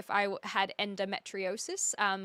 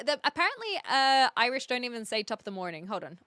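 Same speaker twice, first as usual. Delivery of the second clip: normal talking voice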